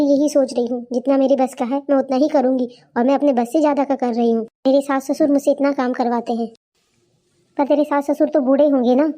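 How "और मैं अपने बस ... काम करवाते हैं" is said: usual speaking voice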